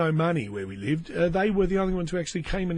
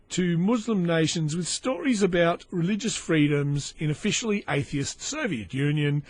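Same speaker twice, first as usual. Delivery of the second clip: ordinary talk, nothing marked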